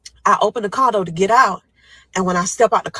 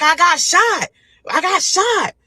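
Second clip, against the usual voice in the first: shouted answer; 11 semitones higher, 4 dB louder, slower than usual. Retold or imitated. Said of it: "So I was like".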